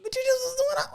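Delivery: high-pitched disbelieving whine; incredulous squeak. Retold or imitated. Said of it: "And I'm like".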